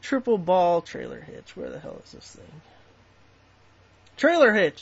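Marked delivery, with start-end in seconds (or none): 0.8-2.6 voiceless grumble to himself; muttered search. Real, not acted. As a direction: mutter while hunting for it.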